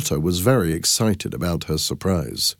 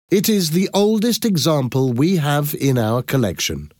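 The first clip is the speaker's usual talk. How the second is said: higher-pitched address to a crowd; exhibition presenter; 7 semitones higher than usual. Acted. Thomas explains the exhibit to the crowd, formal presenting. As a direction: present it proudly to an audience, pitched up.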